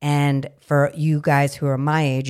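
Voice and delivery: usual speaking voice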